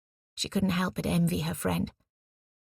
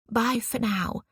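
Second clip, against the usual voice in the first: "for now" picked out with meaning; stress on for now; 6 semitones higher, slower than usual. Retold or imitated. Nancy quotes the doctor's goodbye, stressing "for now". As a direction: lean pointedly on "for now". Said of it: "he emphasized the 'for now' part".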